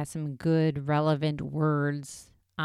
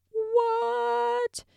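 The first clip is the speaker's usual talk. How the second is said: much higher drawn-out disbelieving what; shocked what. Real, not acted. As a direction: stretch it out in disbelief. Device knocked over, shocked.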